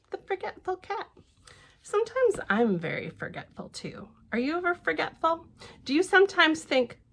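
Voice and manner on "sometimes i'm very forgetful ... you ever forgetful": plain reading voice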